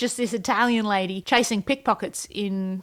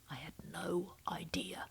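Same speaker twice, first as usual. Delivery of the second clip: much softer whispered admission; whispered confession; 3 semitones lower, 17 dB quieter than usual. Real, not acted. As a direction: whisper it sheepishly. Confessing aside.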